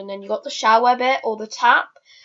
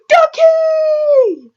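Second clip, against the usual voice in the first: high, loud squeal of "Ducky"; excited shout; 8 dB louder than usual. Retold or imitated. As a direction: shriek it like a thrilled kid spotting the duck. Kids shouting at the duck.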